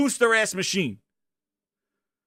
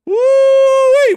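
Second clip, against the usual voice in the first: high, loud woo-wee; excited whoop; 11 dB louder than usual. Real, not acted. Overheated by Phil's news, a loud high woo-wee. Fanning himself over the news.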